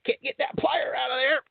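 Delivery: high, strained mock frustration; mock struggling. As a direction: grunt it out like fighting plastic packaging. Acting struggle with packaged tools.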